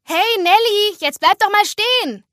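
high, loud call after a friend. Acted. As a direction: shout to make her stop. Friend calls after departing Nelly. (laut hinterherrufen)

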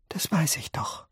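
breathy, toneless whisper. A narrator's reading. Charlie reassures his family, whispering. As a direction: whisper it gently. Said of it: "flüsterte Charlie".

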